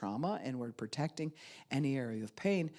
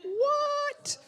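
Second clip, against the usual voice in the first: very high squeal of disbelief; shocked what; 4 dB louder than usual. Retold or imitated. Partner told to get counseling, shocked squeal.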